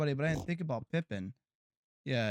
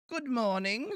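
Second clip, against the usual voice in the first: much higher, swooping sing-song; exaggerated greeting; 9 semitones higher, 3 dB louder, slower than usual. Streamer greeting a viewer over the top, high sing-song. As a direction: overdo the greeting with a big lilt.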